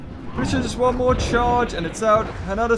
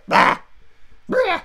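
playful imitated monster roar; mock roar; 11 semitones higher than usual. Copying the creature's cry from the game, mock roar. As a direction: growl it like a toy monster. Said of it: "What was that?".

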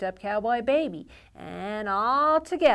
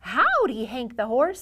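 happy howdy; 4 semitones higher, 6 dB louder than usual. Acted. Cowboy Baby greets horse.

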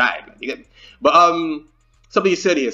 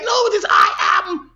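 high, shouted mock teacher correcting; mock English teacher; 13 semitones higher than usual. Reaction video host mocking a teacher's grammar correction, high mock shout. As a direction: squeak out a prissy teacher's correction. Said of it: "English teacher must be like".

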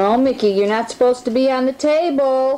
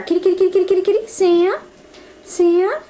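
calling a cat; 8 semitones higher, faster than usual. Real, not acted. Calling the cats at feeding time.

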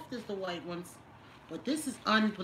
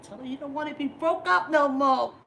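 higher, louder plea; sudden loud urging; 7 semitones higher, 10 dB louder than usual. Real, not acted. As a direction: burst out pushing him to make up. Urging viewer to reconcile.

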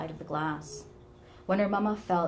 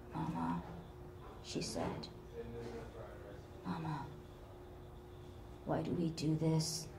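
much softer, near a whisper; a weary, crying plea; soft and tearful; 10 dB quieter than usual. Acted. Helen weeping, asks quietly.

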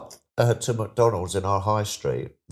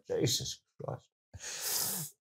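very slow, whispered, much softer; horrified mutter; 11 dB quieter, much slower than usual. Retold or imitated. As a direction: breathe it out in dread in the coffin. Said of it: "I was going".

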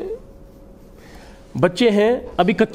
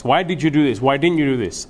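ordinary delivery

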